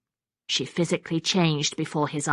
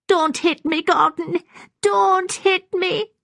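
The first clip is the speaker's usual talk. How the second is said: put-on high-pitched, frightened old woman; frightened pleading; 14 semitones higher, 5 dB louder, slower than usual. A narrator's reading. Maggie cowers before Gordon, frightened high pleading. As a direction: plead in a high voice, dragging the last plea. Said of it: "raising her arms in fear".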